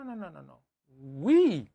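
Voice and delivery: ordinary talk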